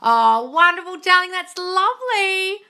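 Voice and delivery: high, loud, drawn-out delight; effusive, swooping praise; gushing congratulations. Real, not acted. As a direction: coo it loudly and slowly with delight. Congratulates viewer on good news.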